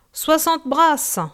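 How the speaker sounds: slow, high, loud drawn-out call. A narrator's reading. Pierre Capucin calling the depth, slow loud call. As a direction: stretch it out so the whole deck hears.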